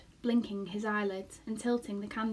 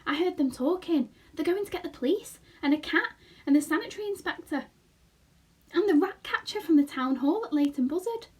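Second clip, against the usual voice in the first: higher, louder anxious report; Pod's voice; 7 semitones higher, 6 dB louder than usual. Acted. Father Pod warning the boy of police and cats, anxious higher voice. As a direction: list the dangers in alarm.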